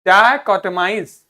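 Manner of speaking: डाइकोटमाइज loud and slow; pronunciation demo. Shown as a demonstration. Teacher reading out the word for pupils, loud, deliberate डाइकोटमाइज. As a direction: project डाइकोटमाइज in distinct syllables. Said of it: "इसको पढ़िए".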